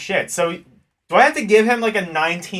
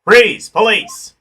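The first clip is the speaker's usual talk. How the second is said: loud cop command; shouting cop; 2 semitones higher, 8 dB louder than usual. Acted. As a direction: bark it like a cop on a raid. Cop bursts in on scene.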